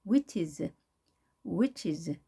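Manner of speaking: "Witches" stressed on "wi", falling ending. Shown as a demonstration. Teacher models "witches" for learners, first syllable stressed. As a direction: hit the "i" in "Witches", then let "ches" drop. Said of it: "I에 강세가 있겠죠".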